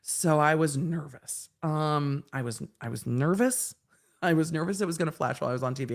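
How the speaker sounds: normal talking voice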